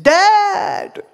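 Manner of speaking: high, loud startled cry of Dad; shocked cry. Retold or imitated. Son spots his father in the dark cinema, shocked loud cry. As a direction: yelp it out in shock.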